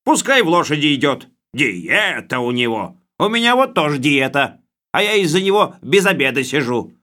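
high, loud, bossy bureaucrat voice; blustering official. Acted. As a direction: lay down the law like a boorish boss. Khlebovodov's blustering speech.

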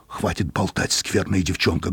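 plain reading voice

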